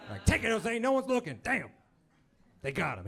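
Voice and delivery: ordinary talk, nothing marked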